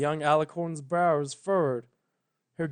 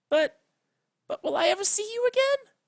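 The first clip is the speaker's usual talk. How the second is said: put-on much higher, young frightened mare; frightened young Twilight; 3 dB louder, faster than usual. Twilight begs Celestia to explain, high and frightened. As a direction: plead like a scared young pony, high and fast.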